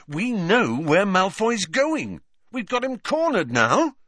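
high, triumphant excitement; eager and exultant; enthusiastic. A narrator's reading. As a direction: relish it, pitched well up. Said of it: "said Harry enthusiastically".

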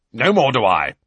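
loud, forceful agreement; forcefully. A narrator's reading. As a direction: boom it out emphatically. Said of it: "said Uncle Vernon forcefully".

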